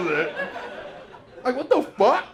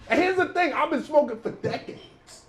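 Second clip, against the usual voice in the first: ordinary talk, nothing marked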